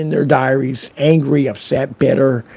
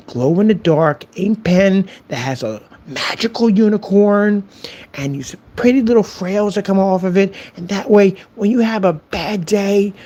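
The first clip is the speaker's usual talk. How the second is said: higher, mock-sweet condescension; straight-faced sarcasm; 6 semitones higher than usual. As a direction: soothe the troll like a small child. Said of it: "I can't believe I said that with a straight face".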